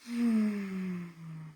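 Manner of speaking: long, falling sigh; audible sighing exhale; deep breath sigh. Shown as a demonstration. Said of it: "taking a deep breath".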